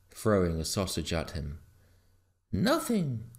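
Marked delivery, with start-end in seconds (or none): 2.5-3.3 high, flustered dog voice; panting, flustered. A narrator's reading. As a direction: protest innocence as a flustered dog. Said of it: "panted Garm, too flustered".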